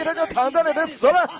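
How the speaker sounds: shouted mockery; mocking jeer. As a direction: jeer at him like a scoffing crowd. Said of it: "他们就耻笑他".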